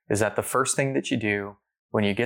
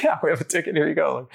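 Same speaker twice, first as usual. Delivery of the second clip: laughing reply; 7 semitones higher than usual. Retold or imitated. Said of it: "I'm like".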